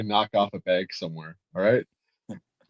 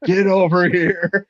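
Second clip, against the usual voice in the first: higher, slow call across the room; mock yell; 8 semitones higher, 3 dB louder, slower than usual. An intelligence officer calls the bunny over, a mock yell. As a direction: imitate an officer shouting for him. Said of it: "And the intelligence officer's like".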